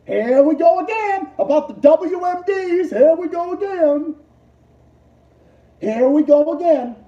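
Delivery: loud, high here-we-go-again rant; exasperated mock shout. Streamer mocking the media, loud and exasperated. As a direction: throw it out, fed up and mocking.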